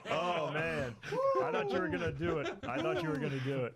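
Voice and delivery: high, giggly teasing; laughing disbelief. Real, not acted. Cohost laughing at the reversal.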